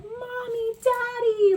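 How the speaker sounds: put-on very high, loud child calling out; excited child. Children running to the door, very high excited call. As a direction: squeal like a kid greeting a parent.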